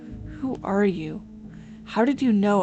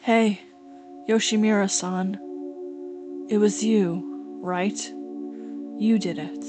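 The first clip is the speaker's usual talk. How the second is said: calm accusation; 8 dB quieter than usual. Acted. Girl confronting Yoshimura over a killing, calm soft accusation. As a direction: accuse quietly, without any heat. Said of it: "completely different from how calm you were".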